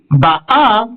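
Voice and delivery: Ba'a with a stressed final A; stress on last syllable. Shown as a demonstration. Teacher proves it is present tense, final-stressed Ba'a. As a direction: put the weight on the end of Ba'a. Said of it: "c'est la dernière syllabe qui est accentuée".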